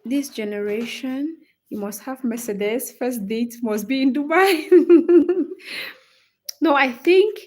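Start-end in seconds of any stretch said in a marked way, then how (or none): 4.7-5.9 high, loud laugh. Real, not acted. Streamer mocking Dubai first-date demands, loud high laugh.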